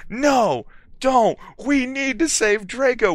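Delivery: high-pitched pleading whine; begging. A narrator's reading. Students begging Dumbledore to save Draco, high pleading. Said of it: "we begged".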